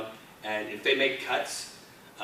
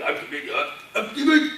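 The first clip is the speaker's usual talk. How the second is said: tentative villain voice, rising higher; General Grievous; 10 semitones higher, 5 dB louder than usual. Retold or imitated. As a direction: feel out the character voice in false starts. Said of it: "I was trying to play with".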